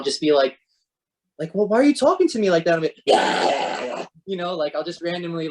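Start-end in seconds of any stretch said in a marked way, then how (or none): 1.5-2.7 fast, high, indignant snap; acted-out huffy objection, rushed; mock outburst. Acts out sudden mood outburst. 3.0-4.1 long rasping voiceless scream; harsh monster cry from the diaphragm; goblin-style screech. Shown as a demonstration. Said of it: "goblin voices and stuff like that".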